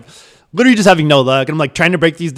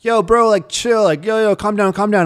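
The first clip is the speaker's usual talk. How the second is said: higher, pleading bid to calm him; calm him down with raised urgency; 7 semitones higher than usual. Retold or imitated. Calming the furious guard, higher pleading voice.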